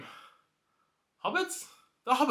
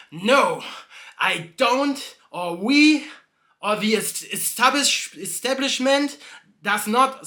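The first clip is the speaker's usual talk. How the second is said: louder, higher and flustered; annoyed barkeep; 5 semitones higher, 4 dB louder, slower than usual. Acted. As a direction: stumble through it as the irritated barkeep. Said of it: "He's like".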